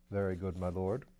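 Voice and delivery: a composed valet answering his master; Bunter the valet. Acted. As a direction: impassive butler, low and quiet.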